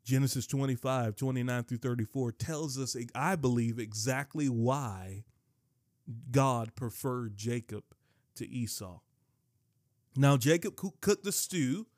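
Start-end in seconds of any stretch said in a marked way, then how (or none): none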